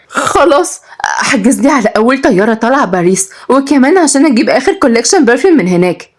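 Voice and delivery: high, breezy entitlement; spoiled heiress. A narrator's reading. Heiress demanding a flight to Paris, entitled.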